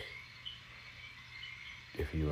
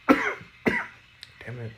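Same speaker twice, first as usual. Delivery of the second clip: strained voice right after coughing; raspy catch after a cough; smoker's rasp; 5 dB louder than usual. Real, not acted. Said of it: "Smoker's cough".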